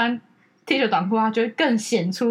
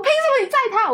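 higher, jealous mock outrage; indignant protest; 11 semitones higher than usual. Sister protesting to brother about the aunt, higher jealous outrage. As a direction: snap it out pitched way up.

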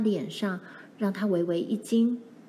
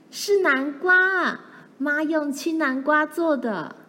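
put-on high, loud, bursting-out child's cry; almost shouting; 7 semitones higher, 4 dB louder, slower than usual. A narrator's reading. Child who can't hold back the pie secret, almost shouting. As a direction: blurt out the secret, high and excited. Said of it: "她几乎大叫着说".

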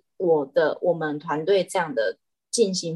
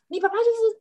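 higher, exasperated scold at the cat; annoyed scolding; 14 semitones higher, faster than usual. Retold or imitated. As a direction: start telling off a spoiled cat. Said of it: "我就很生气". (不耐烦地骂猫)

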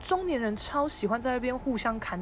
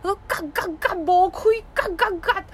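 repeated louder swearing; cursing dad; 9 semitones higher, 6 dB louder than usual. Retold or imitated. Dad cursing at the closed quilt shop, mock swearing. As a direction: swear again and again at the shut shop. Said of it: "他就一直在那边干".

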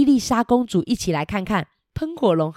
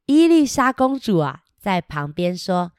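ordinary delivery

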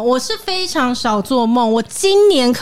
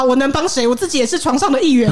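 ordinary delivery

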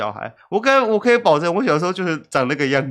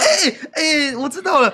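excited recognition; 12 semitones higher, 3 dB louder than usual. Real, not acted. Excited remembering.

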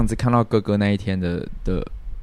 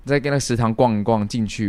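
ordinary delivery

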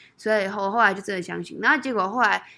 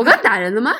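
响亮的惊讶自问; 像刚回过神一样问; mock bewildered; 10 dB louder than usual. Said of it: "我是，诶？". (打完人后愣住的自问，带惊讶)

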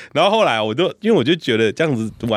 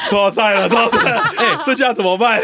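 laughing panic; 8 semitones higher than usual. Retold or imitated. Uncle panics after kids fall.

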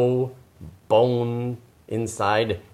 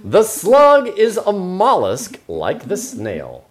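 storybook narration; 6 semitones higher, 5 dB louder, slower than usual. Acted. Reading the picture book aloud.

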